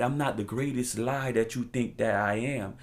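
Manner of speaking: ordinary delivery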